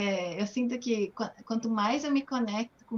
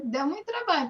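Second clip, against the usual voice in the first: ordinary talk, nothing marked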